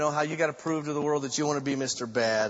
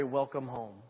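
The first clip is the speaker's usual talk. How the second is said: soft and lower; gentle welcome; 4 semitones lower, 6 dB quieter than usual. Retold or imitated. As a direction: say it like opening arms. Christ welcoming the saved.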